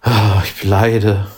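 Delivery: slow, drawn-out mock suffering; Männergrippe. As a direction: moan it like a dying man. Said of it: "Männergrippe". (gespielt leidend, Männergrippe)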